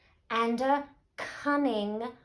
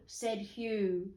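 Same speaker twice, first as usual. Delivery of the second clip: usual speaking voice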